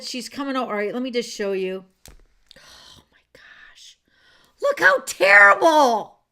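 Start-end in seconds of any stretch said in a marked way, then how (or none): 2.6-4.0 breathless whispered gasp; stunned hushed oh my gosh; awed whisper. Real, not acted. Shocked at truck damage. 4.6-6.2 loud slow wail of dismay; slow, loud look how terrible; dismayed outcry. Real, not acted. Showing truck damage photos.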